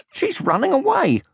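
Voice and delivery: high excited cry; scream. Acted. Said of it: "screamed Sniff".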